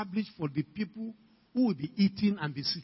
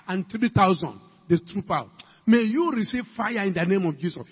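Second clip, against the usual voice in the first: usual speaking voice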